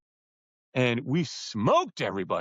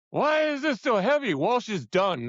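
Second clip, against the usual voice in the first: exasperated complaint; 8 semitones higher than usual. Retold or imitated. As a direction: retell your own outburst in the boat. Retold complaint while rowing.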